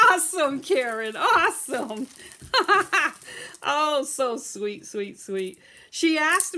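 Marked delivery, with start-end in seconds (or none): 2.1-3.6 loud, high burst of laughter. Real, not acted. Seller delighted by Karen's order, laughing loudly.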